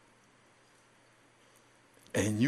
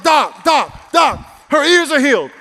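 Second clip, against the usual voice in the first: high, loud retold shout at a doctor; urgent, high-pitched hollering; insistent shouting; 14 semitones higher, 13 dB louder, slower than usual. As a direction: shout to get the doctor's attention.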